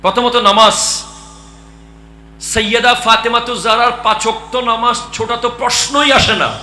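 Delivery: very loud, high-pitched exhortation; full-force preaching. Real, not acted. Preacher extols Fatima's night prayers, full-force preaching. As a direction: belt it out to the whole gathering.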